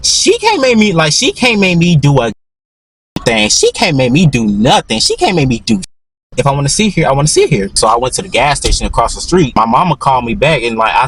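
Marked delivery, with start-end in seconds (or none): 0.0-7.7 defiant. Retold or imitated. Defiant reply to coworker.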